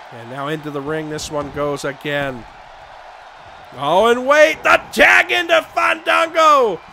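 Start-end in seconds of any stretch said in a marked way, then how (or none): none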